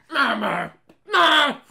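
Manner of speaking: mock animal cry; deer bleat. Imitates deer calling out.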